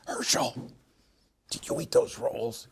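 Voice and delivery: voiceless, strained whisper; yelling without a sound. Retold or imitated. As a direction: yell at him in a hushed whisper. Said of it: "yell at you without making a sound".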